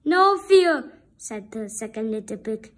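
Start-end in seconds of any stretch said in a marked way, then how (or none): 0.0-1.0 loud firm refusal; pig refusing. Acted. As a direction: shout the refusal back.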